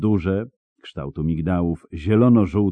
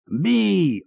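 high-pitched battle yell, acted; narrator's high, contained yell; yelled attack order; 13 semitones higher than usual. A narrator's reading. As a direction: yell the order as you lunge. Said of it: "wrzasnął, rzucając się na Geralta".

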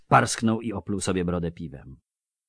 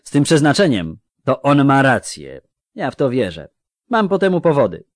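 firm dwarf; 7 semitones higher, 9 dB louder than usual. Acted. Dwarf firmly defending his comrade.

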